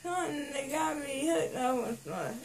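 half-awake after surgery; groggy from anesthesia. Real, not acted.

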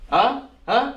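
usual speaking voice

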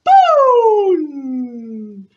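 loud, long imitated boom; rocket bang. Storyteller playing rocket with children, loud long boom. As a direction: burst out with a drawn-out explosion. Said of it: "Hacemos un cohete".

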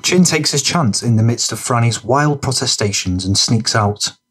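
ordinary talk

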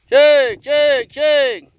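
high, loud call to the herd; calling horses. Real, not acted. Farmer calling his mares in from the pasture, loud high call. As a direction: sing out to bring the mares in.

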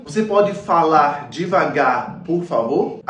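very slow, phrase stretched; slow careful Portuguese; slow demo. Shown as a demonstration. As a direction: model the request slowly. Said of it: "فنقول".

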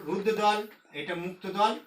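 ordinary talk, nothing marked

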